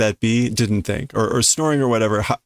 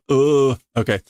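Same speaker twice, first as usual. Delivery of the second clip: ordinary delivery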